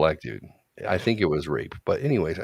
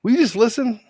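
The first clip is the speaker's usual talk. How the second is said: high, loud mock exasperation; acted-out daughter at wits' end; exasperated; 7 dB louder than usual. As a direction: demand attention with voice raised sharply. Daughter shouts dad down in story.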